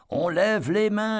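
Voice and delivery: cri lu, aigu et traînant; lance-le haut, en étirant les mots; cri enjoué. A narrator's reading. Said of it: "avait crié l'un d'eux". (cri aigu et étiré)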